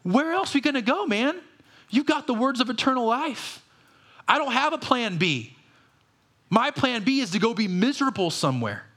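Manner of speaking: casual Peter. Retold or imitated. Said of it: "good old Peter says".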